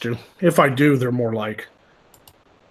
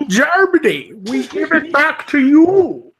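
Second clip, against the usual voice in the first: Bane voice; 14 semitones higher, 5 dB louder, slower than usual. Pretend. Mock Bane line after Bane remark.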